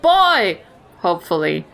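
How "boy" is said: yelled call. Pretend. Player calling for the street urchin, yelled. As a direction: shout it down the street. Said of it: "I yell".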